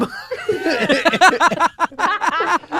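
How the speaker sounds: high burst of laughter. Real, not acted. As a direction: laugh hard at yourself. Laughing at own story.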